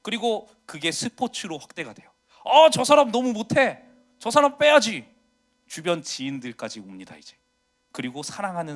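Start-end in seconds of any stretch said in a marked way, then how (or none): 2.4-5.1 higher and louder, scoffing at a bad player; loud armchair heckling; mock sports critic. Complaining about players while watching sports.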